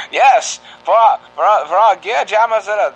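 put-on patriotic old-time radio read; 1940s radio announcer. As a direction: hail the truckers like a newsreel. Continues the radio parody.

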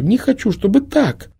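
put-on high girlish refusal, rising in desperation; rising tearful refusal. A narrator's reading. The girl refuses again, high and rising in despair.

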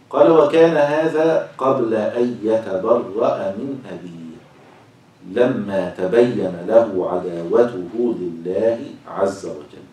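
slow, loud, drawn-out scriptural reading; formal reading aloud. Acted. Teacher reads verse and commentary to class, slow formal reading. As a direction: read it out with stretched, emphatic phrases.

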